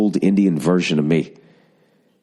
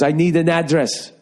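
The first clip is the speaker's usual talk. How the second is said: high, insistent driver's demand; mock yell, pitch jumping up; imitated yelling; 10 semitones higher than usual. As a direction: demand it like an irate cabbie. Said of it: "this guy's yelling at me".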